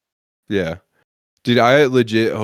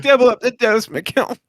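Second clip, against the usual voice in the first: mock plea; 3 dB louder than usual. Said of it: "I was like".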